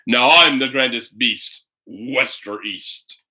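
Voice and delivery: a bear boasting about his new look; thundering bear. Acted.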